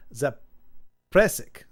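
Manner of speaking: Zapresic drawn out slowly, "zap... RESS-ick"; attempted pronunciation. Shown as a demonstration. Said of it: "it's a tongue twister pronounced".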